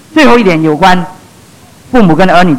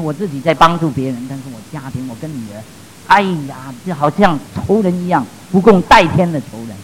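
hushed, pained confession; retold anguish; 4 semitones lower, 6 dB quieter than usual. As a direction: confide a retold heartache in a much softer voice. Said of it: "他很痛苦来跟我说". (模仿痛苦倾诉，压低声音)

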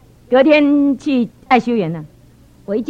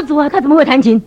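comically exaggerated incredulous outburst; shocked disbelief; 2 semitones higher, 7 dB louder than usual. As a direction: exclaim to the Lord in acted astonishment. Acted shock at rival playing piano.

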